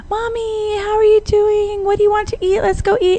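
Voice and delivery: high-pitched imitation of a daughter greeting mom; daughter's voice. Voicing her daughter coming home.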